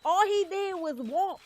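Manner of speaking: amazed that all he did was walk; incredulous emphasis. Real, not acted. Joking about Michael Myers.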